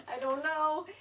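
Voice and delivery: giggling through the words; laughing. Real, not acted. Laughs after clue question.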